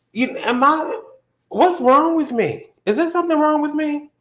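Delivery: high, whiny act of being hurt; high-voiced, exaggerated self-pity on the phone; mock tearful pleading. Retold tearful phone call.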